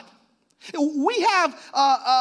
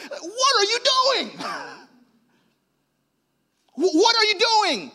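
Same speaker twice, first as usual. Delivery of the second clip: disapproving God; 10 semitones higher than usual. Acted. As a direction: scold like a frowning, appalled God. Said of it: "it looks like this".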